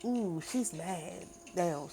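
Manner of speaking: ordinary delivery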